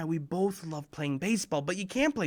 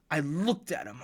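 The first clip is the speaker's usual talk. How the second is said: ordinary talk, nothing marked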